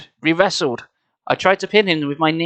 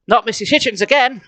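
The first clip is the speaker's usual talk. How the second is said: higher-pitched, mild protest; protesting cry; 6 semitones higher than usual. A narrator's reading. Jordan objecting to the babysitter, protesting. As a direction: object in a raised, complaining voice. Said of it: "I cried".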